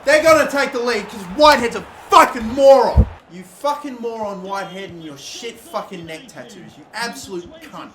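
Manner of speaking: loud, high furious yelling; real rage boiling over; furious rant. Real, not acted. Gamer raging at his defender's blunder, loud furious yelling.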